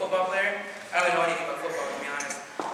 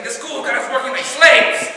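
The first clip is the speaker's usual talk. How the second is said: loud, drawn-out griping; complaining; 5 semitones higher, 8 dB louder than usual. Retold or imitated. Comic imitating a classmate on a school project, loudly complaining. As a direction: whine about the workload at higher pitch. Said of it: "he complains about everything".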